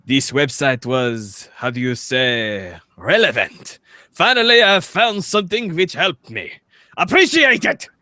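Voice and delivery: mock French accent, read like a grateful Frenchman. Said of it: "makes me want to say it in a stereotypical French accent".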